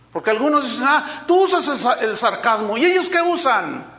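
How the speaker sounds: loud, high-pitched rebuke from the pulpit; forceful preaching. Real, not acted. Preacher defending his sarcasm to the congregation, forceful. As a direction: drive the challenge home to the crowd.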